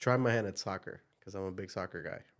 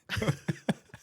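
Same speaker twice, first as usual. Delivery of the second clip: laugh; 12 semitones higher than usual. Real, not acted. Laughing at accent question.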